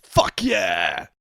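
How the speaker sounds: shouted gym bro hype. Pretend. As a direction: yell it like a lifter hyping up. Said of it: "big gym bro energy".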